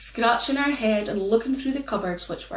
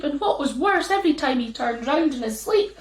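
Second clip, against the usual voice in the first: usual speaking voice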